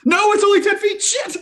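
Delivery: high-pitched protest through laughter; laughing shout. Real, not acted. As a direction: shout it out, cracking up. Joking protest about the distance.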